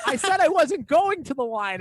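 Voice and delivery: high, loud mock outrage; retold angry protest. Newcomer snapping back at the lady, hammed-up indignation. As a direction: insist loudly, imitating an offended shopper.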